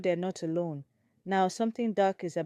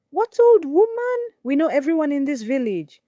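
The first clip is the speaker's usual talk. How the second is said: much higher, louder disbelief; incredulous outburst; 9 semitones higher, 8 dB louder than usual. Acted. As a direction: challenge her in disbelief. Elder doubting the story.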